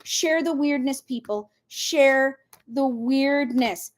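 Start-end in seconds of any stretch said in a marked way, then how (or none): 1.7-3.9 drawn out, word by word; slow emphatic repeat. Real, not acted. Host urging viewers to share with others, slow emphatic repeat. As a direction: stretch out SHARE and WEIRDNESS very slowly.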